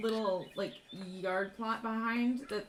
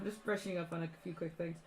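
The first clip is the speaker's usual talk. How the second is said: ordinary delivery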